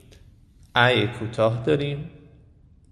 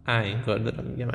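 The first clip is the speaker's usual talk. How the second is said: ordinary delivery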